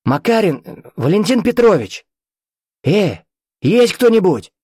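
high, hesitant calls for anyone; calling out. A narrator's reading. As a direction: call round to see who answers. Виктор зовёт пропавших людей.

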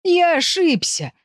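high, drawn-out cry of regret; wailing. A narrator's reading. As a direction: wail it out slowly, pleading. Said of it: "вопил Виль". (отчаянно вопя)

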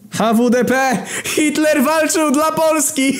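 very high shouted slogan, ending in a laugh; manic Hitler. Acted. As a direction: proclaim it like a crazed dictator. Hitler introduces himself with battle cry.

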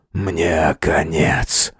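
very slow, low, soft; dying croak. Acted. Wounded man gives up to friend, dying croak. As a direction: eke the words out feebly.

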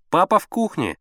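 put-on boy calling back, high pitched; loud answer. A narrator's reading. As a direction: answer loudly to the next room. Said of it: "громко ответил Слава".

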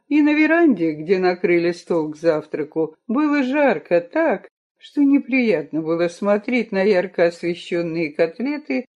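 ordinary delivery

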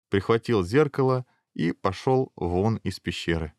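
ordinary delivery

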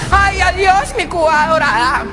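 high, slow wailing goodbye; dying farewell. Retold or imitated. Old man bids farewell to the tattooed flag, high wail. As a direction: sob out adiós to the flag.

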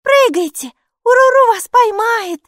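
very high, loud little voice; excited high-pitched urging; calling out. A narrator's reading. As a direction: call up eagerly from below. Maika calls up from hatch.

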